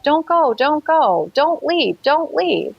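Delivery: high, pleading plea repeated; retold desperate begging. Begging the craft not to go.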